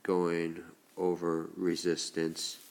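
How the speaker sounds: ordinary talk, nothing marked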